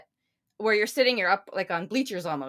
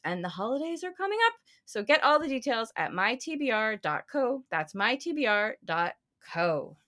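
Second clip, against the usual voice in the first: ad read; 4 semitones higher, 3 dB louder than usual. Acted. Host plugging a paperback subscription, promotional ad read.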